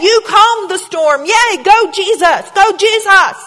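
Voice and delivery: high, loud sing-song cheering; root for Jesus like a pep squad; mock cheerleader. Preacher lampooning worship as cheering Jesus on, loud mock cheer. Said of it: "We're the cheerleaders".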